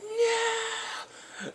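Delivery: very high, drawn-out "no"; mock wail. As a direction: wail it like a dismayed team. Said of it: "they're like".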